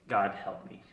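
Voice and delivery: low, very soft, defeated; small retold cry for help, barely voiced; hushed plea. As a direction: pray quietly from rock bottom. Retold prayer while broken down.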